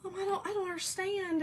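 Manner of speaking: high, plaintive complaint to mom; retold upset. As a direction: act out being hurt and baffled. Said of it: "I was really upset about that".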